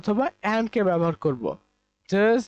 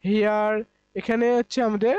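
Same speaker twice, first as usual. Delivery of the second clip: ordinary delivery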